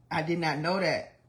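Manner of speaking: normal talking voice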